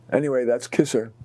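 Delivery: ordinary delivery, nothing marked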